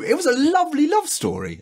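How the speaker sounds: very high, impassioned protest; worked-up squeaky insistence; indignant defence. Real, not acted. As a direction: defend it in a high, upset pitch. Indignantly defends episode, then repeats wryly.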